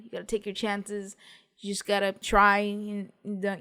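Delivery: ordinary talk, nothing marked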